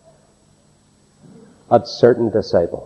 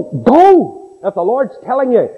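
urgent command; 10 semitones higher than usual. Acted. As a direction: shove him out the door with one word. Urging the man to obey.

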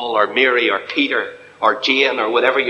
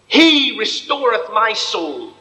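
slow solemn scripture quote; 6 semitones higher, slower than usual. Real, not acted. Preacher quotes psalm solemnly.

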